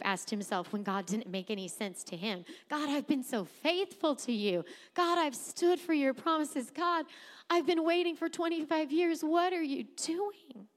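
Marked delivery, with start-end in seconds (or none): 2.7-10.7 higher-pitched, exasperated plea to God; frustrated pleading. Acted. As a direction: plead upward, drawing out the final question. Voicing Job's frustrated plea to God.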